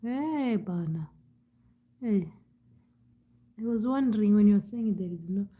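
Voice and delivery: ordinary delivery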